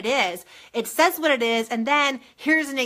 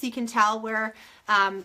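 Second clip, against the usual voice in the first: ordinary talk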